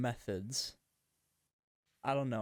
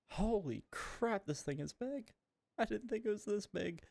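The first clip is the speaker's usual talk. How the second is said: surprised; 10 semitones higher than usual. Real, not acted. First look at huge submarine.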